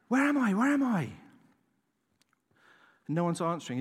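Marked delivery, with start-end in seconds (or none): 0.1-1.1 high, loud panicked cry; panicked screaming. Retold or imitated. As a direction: shout it out lost in the dark. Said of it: "he started screaming in panic".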